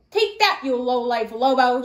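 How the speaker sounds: whack him and shout; grandma shouting as she chases the wolf. Acted. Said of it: "she shouted".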